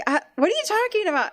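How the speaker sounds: put-on high, quick confused kid; puzzled son. Son asks his mother what she means, quick and high. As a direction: play the baffled son, fast and high. Son's confused reply voiced.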